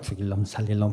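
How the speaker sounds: muttered under breath. Retold or imitated. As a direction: curse them under your breath. 속으로 욕하는 말. (속으로 중얼거리듯 작게)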